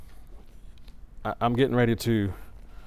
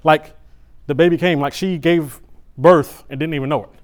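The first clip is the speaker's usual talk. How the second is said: higher, louder, breathless retelling; excited rush; 6 semitones higher, 9 dB louder than usual. Real, not acted. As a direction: relive the chaos of the delivery room.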